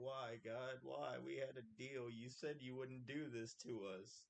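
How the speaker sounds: high, loud plea to the heavens; imitated yell of protest at God; Joey's anguished yell. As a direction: yell it out like a dramatic sitcom complaint. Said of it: "he always just yells out".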